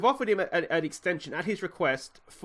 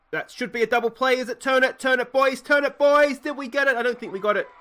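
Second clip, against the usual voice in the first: much higher and louder, rising tension, yelling at the screen; excited shouting; 9 semitones higher, 7 dB louder than usual. Real, not acted. Gamer yelling at a close double play, excited. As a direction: cheer your runner on as the play hangs.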